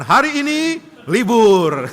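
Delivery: slow, drawn-out, loud high mock announcement; Sunday school teacher declaring no class, mock-grand. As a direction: ham up a lazy teacher. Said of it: "Nanti guru sekolah minggu mengatakan".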